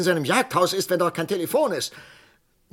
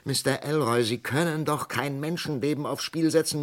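usual speaking voice

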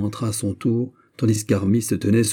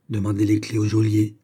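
usual speaking voice

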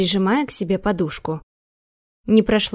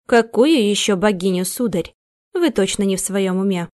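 usual speaking voice

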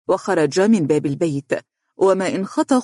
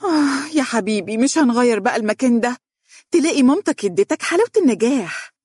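higher, fed-up complaint; exasperated sigh; 8 semitones higher than usual. A narrator's reading. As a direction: puff out the words in irritation. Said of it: "زفرت بقوة وهي تقول".

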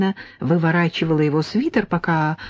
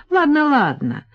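smirking; 9 semitones higher than usual. A narrator's reading. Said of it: "хмыкнула Полина".

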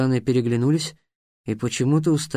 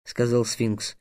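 plain reading voice

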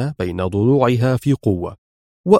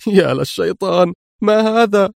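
shocked outcry; 7 semitones higher than usual. Acted. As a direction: cry out at the empty box. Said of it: "في ذهول ومرارة". (صيحة ذهول عالية)

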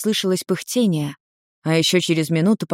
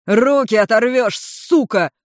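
loud, higher protest; heated, offended yelp; indignant; 5 semitones higher, 5 dB louder, slower than usual. A narrator's reading. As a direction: object in pained outrage. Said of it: "возмутился Улмар".

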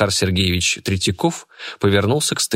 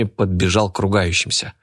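usual speaking voice

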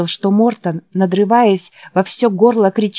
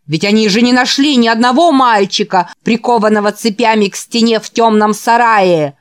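loud, raised, mocking sermon; fiery preaching; 3 semitones higher, 4 dB louder than usual. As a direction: rail against the enemies. Preacher mocks the inspectors. (обличительная проповедь)

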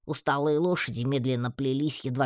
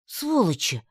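higher, outraged curse; cursing; 6 semitones higher, slower than usual. A narrator's reading. Heroine grasps what her friends did, outraged cursing. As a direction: swear at them in sudden outrage. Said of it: "выругалась я".

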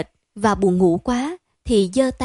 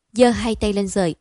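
ordinary delivery

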